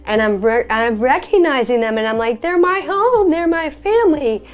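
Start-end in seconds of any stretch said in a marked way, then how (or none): 2.4-4.4 high, emotional, a touch of retold crying; reliving overwhelmed joy; tearful recognition. Recognizing her light-being family, high and tearful. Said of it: "I'm just crying".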